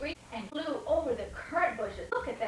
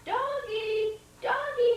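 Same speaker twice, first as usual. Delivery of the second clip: high, louder calling voice; calling the dog; 11 semitones higher, 6 dB louder, slower than usual. Acted. Ash Man calling to the lost dog. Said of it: "he said".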